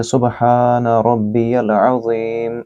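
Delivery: सुबहान with a throaty ह and रब्बियल with a heavy र, said slowly; slow, careful Arabic with the long vowels held; tajweed recitation. Shown as a demonstration. Teacher demonstrating the ruku tasbih, slow careful recitation. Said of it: "यहाँ हा को गले के दरमियानी हिस्से से अदा करें".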